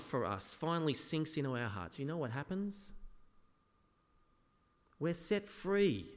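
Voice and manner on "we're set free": slow, deliberate proclamation; slow emphasis. Real, not acted. Preacher telling the congregation they're set free, slow and deliberate. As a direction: let each word land.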